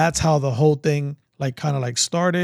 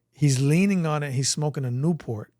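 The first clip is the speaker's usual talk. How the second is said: ordinary talk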